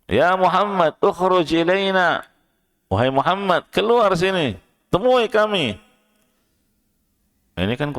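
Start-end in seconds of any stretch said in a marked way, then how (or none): 0.0-2.3 shouting "Ya Muhammad" loud and high, falling at the end; demanding, raised voice yelling to be let in; high-pitched calling. Retold or imitated. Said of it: "memanggil beliau dengan nada yang tinggi". 2.9-5.8 "keluar sini" yelled much higher and louder; high-pitched calling. Retold or imitated. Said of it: "memanggil beliau dengan nada yang tinggi".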